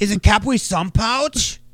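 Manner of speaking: put-on high, loud excited kid voice; childish squeal over a juice pouch; pretend little kid. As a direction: play a thrilled small child asking for juice. Pretend kid lawyer asks for juice.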